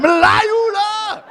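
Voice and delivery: high, slow, strained shriek of Melayu lah; shrieking wife. Retold or imitated. Wife turning on her Malay pride, high drawn-out shriek. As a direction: stretch it out in a shrill cry. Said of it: "She was like".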